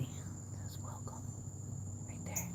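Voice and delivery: hushed whisper so no one hears. Real, not acted. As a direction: whisper it secretly. Whispering showing decoration.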